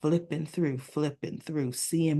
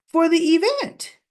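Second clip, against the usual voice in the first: high, loud mock insistence; emphatic answer; 11 semitones higher, 5 dB louder than usual. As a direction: play someone stating the obvious to Eva. Telling Eva the obvious.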